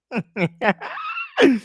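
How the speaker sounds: high, loud burst of shrieking laughter. Real, not acted. Host cracking up at his own advice, shrieking laugh. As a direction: let out a big shrieking cackle.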